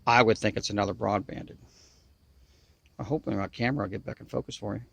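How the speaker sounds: usual speaking voice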